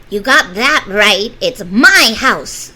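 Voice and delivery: loud, slow angry snap; snapping witch. Acted. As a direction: bite the words off at her. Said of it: "snapped the witch".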